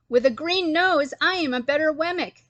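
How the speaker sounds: excited boasting exclamation; exclaimed. A narrator's reading. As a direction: show off, thrilled with yourself. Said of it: "exclaimed a third".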